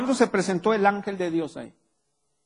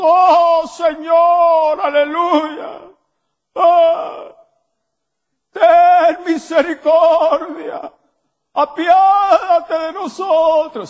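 much higher, much louder, slow dragged-out cries; hammed-up desperate pleading to God in a changed voice; mock wailing prayer voice; 12 semitones higher, 11 dB louder, much slower than usual. Preacher parodying churchgoers' prayers, slow high loud wailing plea. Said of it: "hasta les cambia la voz".

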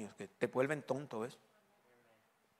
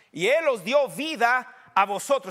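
slow, raised preacher's reading; forceful scripture reading; emphatic preaching; 7 semitones higher, 9 dB louder, slower than usual. Real, not acted. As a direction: proclaim the verse, leaning on key words.

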